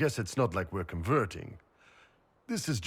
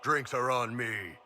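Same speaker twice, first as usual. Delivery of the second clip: higher, louder commander's call; rousing orders; 5 semitones higher, 4 dB louder than usual. Acted. Bull sending his Chargers into battle, rousing shout. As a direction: pump them up before the fight.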